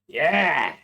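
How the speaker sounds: put-on boastful drunk cheer. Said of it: "he's just like".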